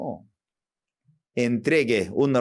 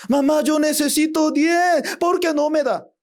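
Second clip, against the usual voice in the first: put-on high, complaining child's voice; demanding child; 12 semitones higher than usual. Child demanding ten from mother, high and complaining. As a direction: push mom for more, pitched high. Child asking for more money.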